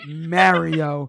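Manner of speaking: loud "Mario" with the a of "marry"; stubborn own pronunciation. Retold or imitated. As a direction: insist on "Mario" as MARE-ee-oh. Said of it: "I'm like".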